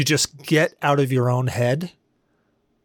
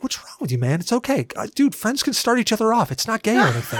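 mock reassuring; 7 semitones higher than usual. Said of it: "He's like".

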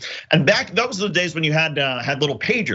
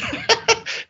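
high laugh. Real, not acted. Laughing at own story.

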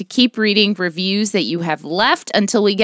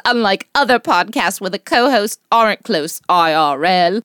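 mock British accent, breaking into laughs; 2 semitones higher than usual. Podcast mom reads a fan review aloud in a mock British accent. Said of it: "Do your British accent".

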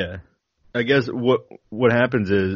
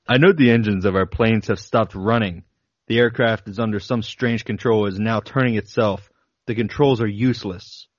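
steady, deliberate reading of a flight log; reading a diary aloud. Acted. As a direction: read it like a pilot's logbook entries.

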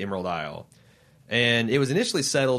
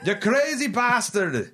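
much higher, slow and drawn-out hammed-up Irish insult; mock Irish insult; 11 semitones higher, slower than usual. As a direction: stretch the insult out like a cranky old Irishman. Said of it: "They call each other".

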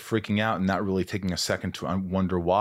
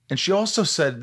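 normal talking voice

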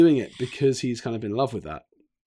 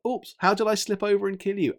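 higher-pitched fake oops, as if it were an accident; bumbling mock innocence; 8 semitones higher than usual. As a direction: play the hapless bumbler. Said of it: "a bumbling kind of like".

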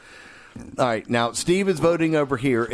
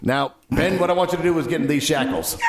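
laughing coach; 6 semitones higher than usual. Acted. Coach role, laughing shout.

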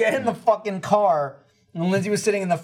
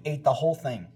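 usual speaking voice

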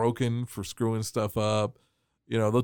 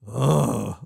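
Frankenstein grunt; 3 semitones lower than usual. Retold or imitated. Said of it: "he's like Frankenstein".

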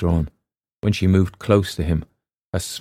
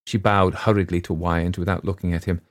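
plain reading voice

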